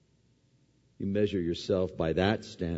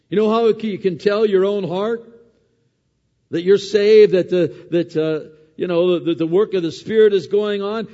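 louder and higher, driving the point home; rising preaching; 9 semitones higher, 11 dB louder than usual. Acted. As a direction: build to full preaching force. Preaching climax.

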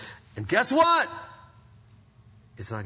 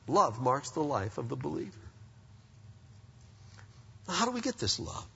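ordinary talk, nothing marked

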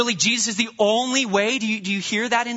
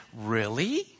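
low, soft skeptical really with a rise; a quiet doubting challenge; 8 semitones lower, 6 dB quieter than usual. Shown as a demonstration. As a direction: cast doubt with one word. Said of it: "somebody comes in and".

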